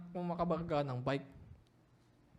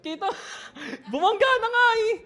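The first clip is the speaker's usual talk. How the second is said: laughing mock indignation, much higher; incredulous retort; 11 semitones higher, 8 dB louder than usual. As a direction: argue back in disbelief. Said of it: "Sabi ko".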